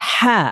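হা from the middle of the throat, breathy; Arabic letter ha. Shown as a demonstration. Teacher models হা for the class. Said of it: "হরফটির উচ্চারণ হলো".

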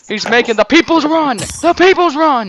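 loud, high wrestling call; hyped commentator shout. Pretend. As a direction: shout the signature move to the crowd.

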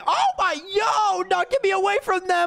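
high-pitched frantic yell; mock panic. Gamer flees the creepy sisters, panicking. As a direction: cry for rescue in hammed-up alarm.

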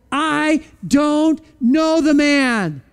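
each word dragged out slowly, a touch defiant; slow sworn denial. Acted. Peter swearing he never knew Jesus, slow and dragged out. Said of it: "started to curse and swear with an oath".

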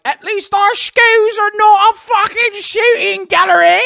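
high, loud mock British heckler; over-the-top British taunt at full volume; shouted British accent. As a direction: bellow it in a hammed-up British accent. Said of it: "Oi Bruv that has come in here and been like".

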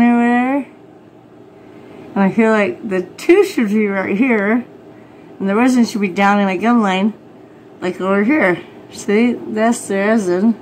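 talking while pulling lip down; finger in mouth. Real, not acted. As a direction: lip held open by finger. Seen 1: Finger pulls lower lip down showing gum; briefly lowered near 3.4s.

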